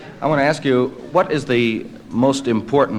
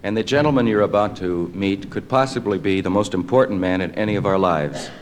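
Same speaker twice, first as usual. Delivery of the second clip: measured, lower announcer delivery; old radio announcer; 4 semitones lower than usual. Acted. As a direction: introduce the guest like a newsreel. Introduces the interview show.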